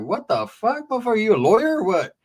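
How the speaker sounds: high-pitched, retold disbelief; incredulous. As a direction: question a friend in playful amazement. Retold disbelief at friend's wealth.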